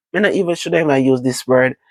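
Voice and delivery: usual speaking voice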